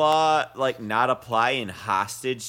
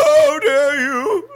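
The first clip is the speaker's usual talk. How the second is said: high, loud, slow mock outrage; 11 semitones higher, 11 dB louder, much slower than usual. Imitating the villain after the slap, in high loud mock outrage. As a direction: ham up the villain's indignant cry. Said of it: "He's like".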